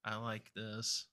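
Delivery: grinning relish. Acted. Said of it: "I grin at that. I'm like".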